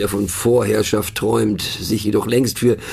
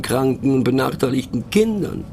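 usual speaking voice

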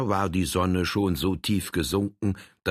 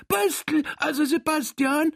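high, eager little Saxon man. Acted. Saxon character boasts to Frank, high and eager. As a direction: squeak it out like a proud little Saxon.

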